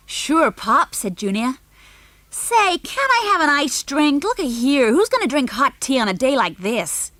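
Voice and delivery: put-on high, loud kid's demand; spoiled boy demanding an iced drink; whiny American boy. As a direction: whine for a cold drink like a spoiled brat.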